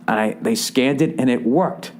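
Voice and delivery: ordinary delivery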